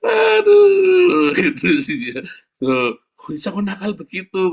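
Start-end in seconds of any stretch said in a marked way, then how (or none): 0.1-1.3 high, loud aduh of pain; pained cry. Retold or imitated. Child howling at pulled leg hair, very high and loud. 2.6-4.5 father scolding. Retold or imitated. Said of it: "kata papanya".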